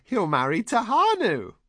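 very high, loud glee; high-pitched, overjoyed shout; joyful shriek. A narrator's reading. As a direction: shriek it with delight. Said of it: "the woman shrieked, joyful".

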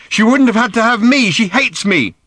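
high, loud, hurt outburst; anguished anger. A narrator's reading. Daughter says her mother hates her, angry and hurt. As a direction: cry out the hurt angrily.

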